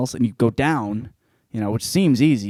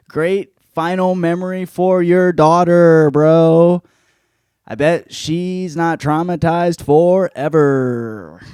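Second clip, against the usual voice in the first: slow, drawn-out sarcasm; mock sympathy for the daughter; sarcastic; 4 semitones higher, 5 dB louder, much slower than usual. Real, not acted.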